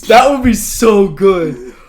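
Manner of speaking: high, loud, drawn-out enthusiasm; delighted. Real, not acted. Host thrilled by co-host's moral idea, delighted. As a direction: gush loudly over the idea.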